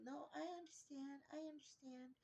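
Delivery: high, soft imitation of a woman on the phone; small, high pleading voice; woman's voice. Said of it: "I hear a woman's voice".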